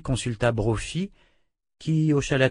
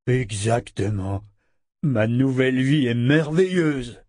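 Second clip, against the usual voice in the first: slow, drawn-out wolf voice, swelling with joy; delighted wolf; 2 semitones higher, 3 dB louder, slower than usual. A narrator's reading. Wolf tells the child of his new life, slow delighted voice. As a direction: savour every word as a happy animal.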